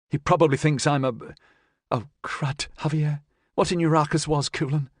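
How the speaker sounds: put-on flustered young woman, a bit rushed; young woman character. A narrator's reading.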